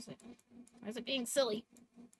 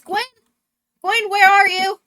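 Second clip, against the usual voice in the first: high, loud searching shout; calling out; 10 semitones higher, 13 dB louder than usual. Acted. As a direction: holler for someone who is missing. Ron runs out calling Gwen.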